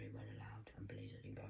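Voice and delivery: hushed confiding whisper; whispered aside. Real, not acted. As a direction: drop to a whisper like a secret.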